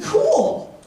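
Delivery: very high, giddy squeal of delight; delighted reaction. Retold or imitated. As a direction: pitch it way up, thrilled. Acting out his delighted reply.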